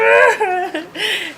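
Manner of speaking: high comic wail; exaggerated bawling, played for laughs; mock crying wail. Driver retelling how they freaked out, mock crying wail. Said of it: "I just start crying".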